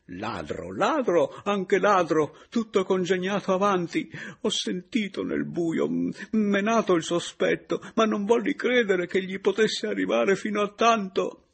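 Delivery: building to a high, loud, tearful wail; near-raving. Acted. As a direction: rave desperately, high and loud. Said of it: "quasi farneticando". (farneticando, con grida di pianto)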